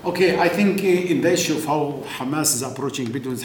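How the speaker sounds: ordinary talk, nothing marked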